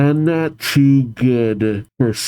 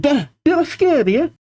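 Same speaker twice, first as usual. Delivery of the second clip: high-pitched, put-on Scottish girl; aggressively Scottish. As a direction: startle them in an aggressive Scottish accent. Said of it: "Should I make her voice aggressively Scottish?".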